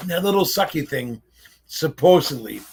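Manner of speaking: ordinary delivery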